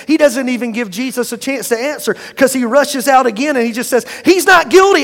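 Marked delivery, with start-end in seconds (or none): 4.2-5.0 higher, louder burst; retold exclamation. Governor Pilate declaring to the crowd, loud burst. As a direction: blurt it out as Pilate.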